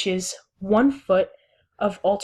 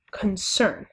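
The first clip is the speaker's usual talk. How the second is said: concern drawn apart with weight on CERN; stress on second syllable. Shown as a demonstration. Teacher giving an iambic example, concern stressed on CERN. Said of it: "the second syllable is stressed".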